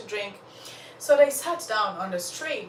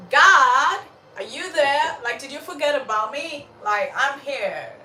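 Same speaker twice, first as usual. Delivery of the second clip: loud, high mock plea for attention; playful exasperated shout, easing at the end; calling out to God; 6 semitones higher, 8 dB louder than usual. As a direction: call up to heaven, feeling forgotten. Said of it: "I've been in that spot of my life".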